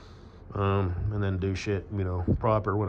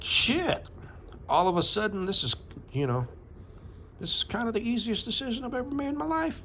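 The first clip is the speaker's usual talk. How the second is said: squeaky high mocking voice of him, pitched way up; mock high guy voice. Mocking the guy who picked her over the rest, squeaky high. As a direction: play him as a squeaky cartoon of himself. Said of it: "And he was like".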